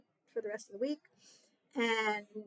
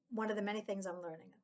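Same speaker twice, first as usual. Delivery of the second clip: ordinary talk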